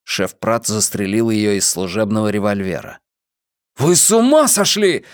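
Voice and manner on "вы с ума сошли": high, outraged yell; horrified, raised-pitch outburst. A narrator's reading. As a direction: yell it in disbelief. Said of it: "завопил Трэвис".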